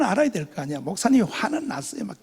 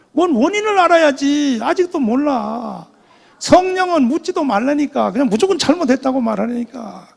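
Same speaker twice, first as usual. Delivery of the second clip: wailing complaint; 5 semitones higher, 5 dB louder than usual. Retold or imitated. Retold wailing complaint going home.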